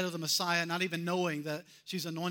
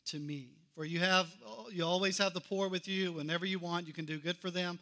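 ordinary delivery

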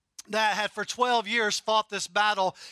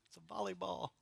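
it's a volleyball dropped to a near murmur, much softer; soft repeat; 13 dB quieter, faster than usual. Real, not acted. Preacher repeating the point to the congregation, softly. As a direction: let "volleyball" fall away quietly. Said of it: "repeated soft".

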